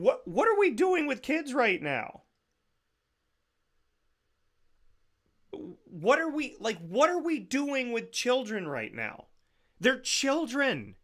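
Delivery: very high, exasperated plea; incredulous protest. Real, not acted. Host asking what we're doing with kids, aghast. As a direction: let the voice leap up, aghast.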